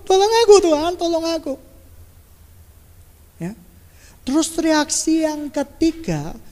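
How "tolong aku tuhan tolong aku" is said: high, loud cry for help to God; hammed-up yelling prayer; shouted plea. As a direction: call out desperately, pitched right up. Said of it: "Teriak-teriak sama Tuhan Yesus".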